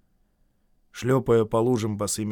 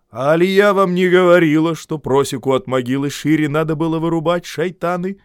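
louder, higher scornful rebuke; contemptuous reproach; 5 semitones higher, 8 dB louder than usual. A narrator's reading. The mother-in-law scorns the men in a nearly masculine voice. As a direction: scold them like a stern matriarch. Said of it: "почти мужской голос, презрительно укоряя".

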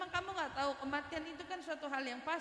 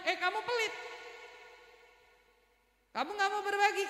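raised, louder reproach; scolding rebuke; 7 semitones higher, 3 dB louder than usual. Acted. Preacher rebukes those who withhold God's gifts, raised scolding. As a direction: pitch it up and call them out.

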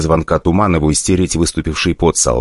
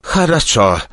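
moaned; 8 semitones higher, slower than usual. Acted. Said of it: "простонал".

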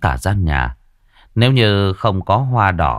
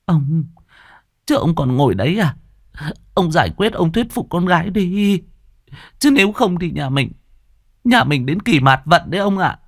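higher, anxious wife pressing her husband; urgent pleading wife; 9 semitones higher than usual. Acted. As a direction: nag him to get up and save the family. Wife urges husband to act.

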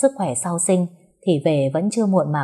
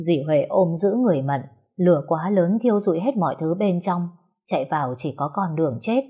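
plain reading voice

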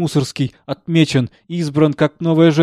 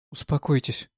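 quiet; 6 dB quieter than usual. Acted. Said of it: "тихо сказала она".

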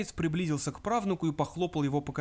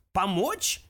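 louder, higher, sharply rising question; flabbergasted old ghost; amazed; 5 semitones higher, 5 dB louder than usual. A narrator's reading. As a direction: repeat it in sheer astonishment. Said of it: "повторил призрак в изумлении".

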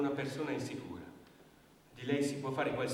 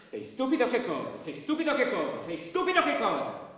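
furious shouted taunt; 9 semitones higher, 8 dB louder than usual. Acted. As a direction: scream the insult, much higher and louder. He mocks her repeatedly.

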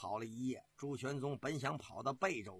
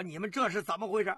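quick, loud, raised-pitch question; urgent demand; 5 semitones higher, 6 dB louder, faster than usual. Acted. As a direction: demand an explanation fast. Commander questions mutilated soldiers. (急促高声质问)

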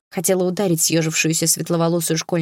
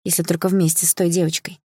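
usual speaking voice

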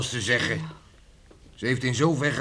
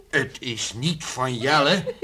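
ordinary delivery

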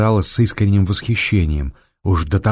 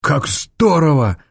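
slow, thrilled cry; delighted exclamation; 7 semitones higher, slower than usual. A narrator's reading. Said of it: "воскликнул Митя".

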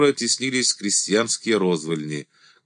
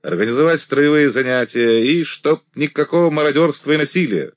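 loud, higher commanding voice; 6 semitones higher, 5 dB louder than usual. A narrator's reading. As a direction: address the whole regiment firmly. Commander addressing regiment.

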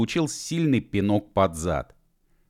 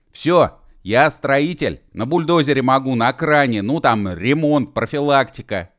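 higher, loud list; eager calling; 6 semitones higher, 6 dB louder than usual. Acted. Mashkov running beside the platform, loud eager calling. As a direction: shout out your skills to win a place. Shouting his skills while running.

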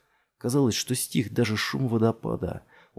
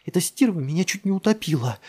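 high, heated complaint; agitated, pitched-up outrage; indignant hissing; 9 semitones higher, 4 dB louder than usual. A narrator's reading. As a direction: fume about nearly being drowned. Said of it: "прошипела Джессика".

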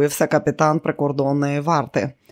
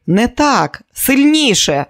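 loud higher demand; shouting; 8 semitones higher, 6 dB louder, slower than usual. Acted. Diana shouting at Kelly to slap harder, loud. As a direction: bark the order to hit harder.

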